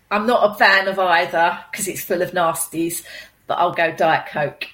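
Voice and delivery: ordinary talk, nothing marked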